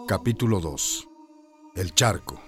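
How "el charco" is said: ordinary delivery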